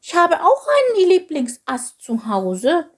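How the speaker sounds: slow, high little creature voice; sheepish confession. A narrator's reading. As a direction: own up meekly, drawn out and high. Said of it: "fragte Chilp kleinlaut".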